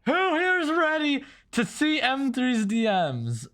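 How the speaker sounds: high, slow, drawn-out showman voice; hype announcer. Pretend. Streamer teasing chat before opening DMs, slow hype-host voice. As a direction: build the chat's anticipation like a host.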